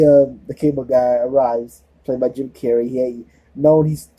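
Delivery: normal talking voice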